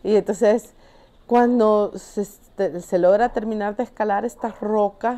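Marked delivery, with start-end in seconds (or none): none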